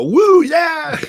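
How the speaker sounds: high, loud fan whoop; excited cheer. Acted. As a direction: whoop like a fan whose team just scored. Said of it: "you're not really supposed to go".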